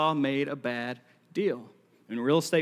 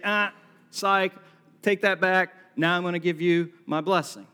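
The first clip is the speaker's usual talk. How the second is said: mock take-back; 3 semitones higher, 5 dB louder than usual. Mock Isaac taking blessing back.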